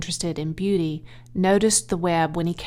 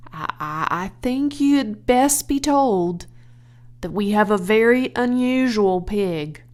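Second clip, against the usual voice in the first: trying to stay steady; 4 dB louder than usual. Acted. Husband tells his wife the news, hesitant, voice straining. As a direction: force the words out carefully. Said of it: "trying to keep his voice steady".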